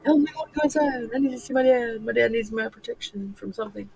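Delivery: high, pleading dog's voice; voicing the dog. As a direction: speak for the dog like a whiny kid. Owner voicing the dog's plea.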